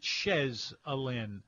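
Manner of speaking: Chez said "shez", with the Z sounded; local pronunciation. Shown as a demonstration. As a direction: say "Chez Helene" as shez uh-LIN. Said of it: "they pronounced it Chez".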